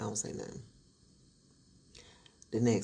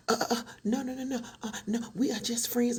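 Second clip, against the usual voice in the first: high, flustered stammering denial; caught-out mistress, mock panic; 9 semitones higher than usual.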